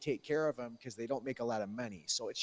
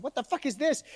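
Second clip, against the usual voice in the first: much higher, louder alarmed yell; shocked shout; 11 semitones higher, 5 dB louder than usual. Retold or imitated. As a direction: shout it as the masks come off. Lizard people unmask.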